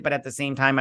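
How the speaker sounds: ordinary delivery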